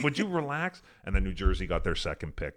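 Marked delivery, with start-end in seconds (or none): 0.0-0.8 high, mock exasperated plea. Son telling his fuming father to calm down, high and exasperated. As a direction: hush him with a pitched-up plea.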